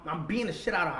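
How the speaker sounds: normal talking voice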